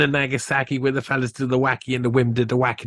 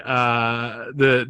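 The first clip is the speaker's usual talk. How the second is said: ordinary talk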